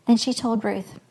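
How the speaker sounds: plain reading voice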